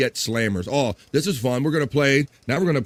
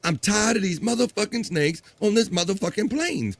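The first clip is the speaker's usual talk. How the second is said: Samuel Jackson; 8 semitones higher than usual. Acted. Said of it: "Samuel Jackson".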